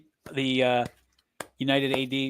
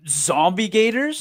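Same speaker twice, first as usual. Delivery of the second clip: slow, higher-pitched disbelieving question; incredulous; 5 semitones higher, 3 dB louder, slower than usual. Real, not acted. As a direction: draw it out in disbelief, rising at the end. Incredulous echo of co-host's idea.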